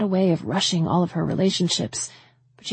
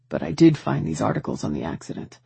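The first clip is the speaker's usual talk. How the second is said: grave brother, voiced lower; low, urgent, pressing questions; lower male voice; 8 semitones lower than usual. A narrator's reading. Tanner's voice in the story.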